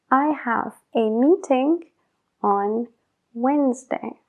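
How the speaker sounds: I have a meeting, pause, on, pause, Wednesday; split phrase. Shown as a demonstration. Teacher slicing the example sentence into pieces. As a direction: pause between each part of the phrase. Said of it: "broken into chunks".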